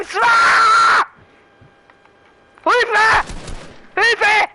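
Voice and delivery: high, loud panicked yelling; shouting for help. Real, not acted.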